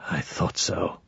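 very soft, low aside; whispered. A narrator's reading. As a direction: drop it right down, almost to a whisper. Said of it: "Bowman's whispered".

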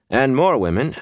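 usual speaking voice

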